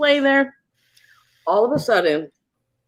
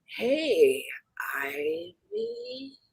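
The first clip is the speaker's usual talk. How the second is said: high, soft ghostly whisper; creepy whisper; 11 semitones higher, 9 dB quieter, much slower than usual. Retold or imitated. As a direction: whisper the name like a female ghost. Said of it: "no, it said".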